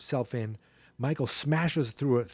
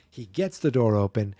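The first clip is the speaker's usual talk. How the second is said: normal talking voice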